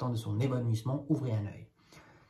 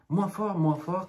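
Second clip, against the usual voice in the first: higher, louder mock yell; crying out; 6 semitones higher, 3 dB louder, slower than usual. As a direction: shout him down, hands over ears. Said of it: "crièrent les deux cent soixante-sept marchandes".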